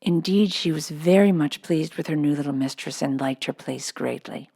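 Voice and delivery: ordinary delivery, nothing marked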